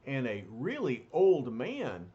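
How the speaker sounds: usual speaking voice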